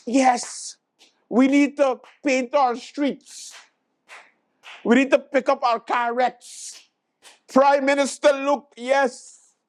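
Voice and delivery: slow, fawning cheers of the crowd; mock adoring crowd. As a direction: gush like a starstruck supporter. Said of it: "everybody like".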